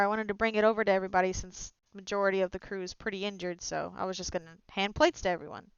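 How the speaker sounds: ordinary talk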